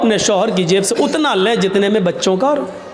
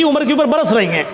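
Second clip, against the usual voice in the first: usual speaking voice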